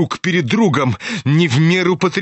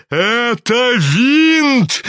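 high, slow drawn-out cry of outrage; old general shouting; 6 semitones higher, much slower than usual. A narrator's reading. Old general yelling at the sneering young man. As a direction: stretch each word out in a high shout. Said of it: "кричал генерал".

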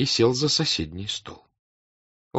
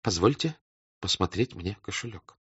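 softer, barely audible; quiet, barely audible; 6 dB quieter than usual. Acted. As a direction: say it almost under the breath. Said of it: "тихим, чуть слышным голосом".

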